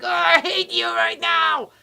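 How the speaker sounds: loud, high burst of mock hatred; intense aggravation. Partner sending angry energy out, loud acted outburst. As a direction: let the angry energy explode. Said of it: "that energy that is like".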